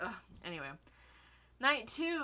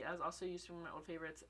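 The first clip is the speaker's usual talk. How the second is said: ordinary delivery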